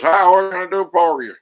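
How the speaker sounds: high, loud chirpy phone greeting; cheery repair man. Retold or imitated. Local repair man answering the phone, high and loud. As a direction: play the eager repair guy picking up.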